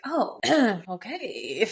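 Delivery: slow, throat-clearing discomfort; imitated awkwardness. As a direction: clear your throat and draw out an awkward acknowledgment. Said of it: "That was awkward".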